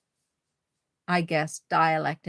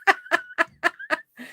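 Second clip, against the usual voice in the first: burst of laughter. Real, not acted. Reacting to bread cake debate.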